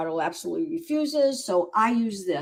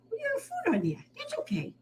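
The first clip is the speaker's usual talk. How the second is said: "We got food on here" much higher and softer; 7 dB quieter than usual. Real, not acted. Rescuer soothes the messy kitten, much higher, softer baby talk. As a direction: coo "food" softly up high.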